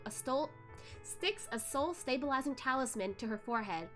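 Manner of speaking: ordinary delivery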